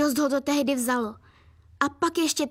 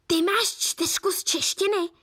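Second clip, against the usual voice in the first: higher, slow incredulous question; disbelieving; 5 semitones higher than usual. A narrator's reading. Mum reading daughter's report card, disbelieving. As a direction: draw it out as if unable to believe it. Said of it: "vydechla nakonec nevěřícně".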